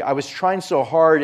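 urgent emphatic retelling; pressing emphasis. Retold or imitated. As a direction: push it like you are fighting to save him. Preacher retells surgery, building emphasis.

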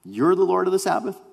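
high-pitched acted disbelief; incredulous question. Crowd questions Jesus' claim to be Lord, incredulous. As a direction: challenge the claim in astonishment.

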